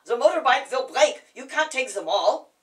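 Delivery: loud, urgent protest; retold shout. Companion shouting that the shoes will break the bike, loud protest. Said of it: "And he said".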